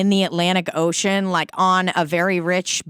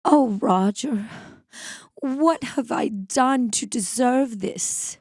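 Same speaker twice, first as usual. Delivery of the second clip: slow, drawn-out Mid-Atlantic movie melodrama; old-timey movie accent; 5 semitones higher, slower than usual. Acted. Imitating old movie actors for laughs, theatrical Mid-Atlantic accent. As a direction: plead in an old-timey American film accent. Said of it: "really old-timey movie and it's, like, clearly American people".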